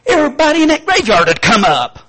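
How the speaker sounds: high, loud, ringing pulpit voice; full-force preaching. Acted. Preacher thundering to the congregation about the graveyard. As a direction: preach it out to the back row.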